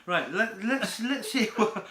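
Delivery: laughing. Real, not acted. Laughing while starting.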